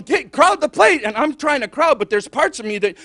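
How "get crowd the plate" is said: high, loud urgent instruction; coach urging. Acted. Coach pushing a scared batter forward, urgent yell. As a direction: yell advice from the dugout. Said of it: "the coach is going".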